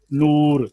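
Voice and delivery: नूर with a long oo; long vowel demo. Shown as a demonstration. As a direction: stretch the oo in नूर to two beats. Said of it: "जैसे नूर में वाओ".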